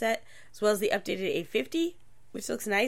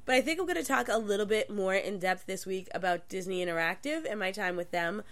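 ordinary talk

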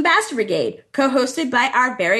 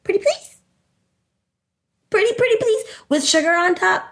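high pleading voice; 7 semitones higher than usual. Pretend. Begging listeners to join.

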